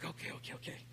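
soft whispered surrender; whispering okay to the Spirit. Retold or imitated. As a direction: give in under your breath. Giving in to the voice.

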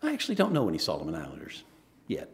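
low, matter-of-fact calm reply. Retold or imitated. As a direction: imitate a calm, unruffled answer. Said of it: "Becca very calmly said".

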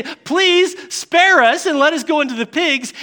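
high, urgent begging; acted pleading. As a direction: plead for mercy, lightly dramatized. Voicing demons begging Jesus.

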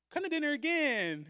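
high, sweet little invitation; coy invitation. Retold or imitated. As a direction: lure him back with a lilt. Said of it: "And she goes".

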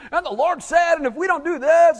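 high, loud, shouting televangelist parody; mocking a TV preacher who shouts for money. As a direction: ham up a frantic TV preacher begging. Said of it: "you see, especially on T V".

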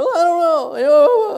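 very high, loud comic wail; almost crying. Retold or imitated. Friend answers preacher helplessly, mock crying wail. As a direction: blubber it helplessly, hammed up. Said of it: "we're almost crying".

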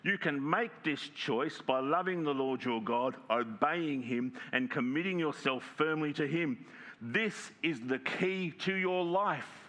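slow, rising emphasis ending high on key; big underline. Shown as a demonstration. Preacher underlining the key verse, slow and rising. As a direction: stretch each phrase out to underline it. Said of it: "here's the big underline".

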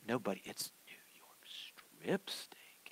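put-on hushed whisper to the boy; slow secretive whisper. Dad hushing son about the steak.

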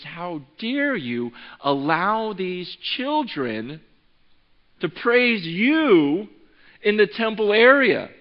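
slow, drawn-out, rising outrage; furious indignation. Retold or imitated. Religious leaders confronting Jesus over praising children, indignant. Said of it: "They are furious".

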